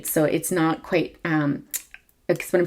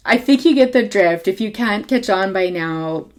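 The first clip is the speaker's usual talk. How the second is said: high, loud sarcastic tease; mock-helpful sarcasm, pitched up; 3 semitones higher, 6 dB louder than usual. Real, not acted. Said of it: "that's my air sarcasm there".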